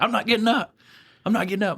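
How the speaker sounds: high-pitched stubborn protest; mock refusal. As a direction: imitate a man who won't budge. Inmate refusing to rise.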